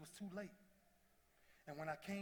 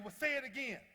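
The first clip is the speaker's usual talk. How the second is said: higher, louder dare; defiant challenge; 8 semitones higher, 8 dB louder than usual. Retold or imitated. Player daring his doctor, higher and louder. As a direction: challenge her to repeat it.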